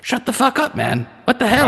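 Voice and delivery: high, sharp crone snap; angry old hag. Acted. Crone snaps at the player.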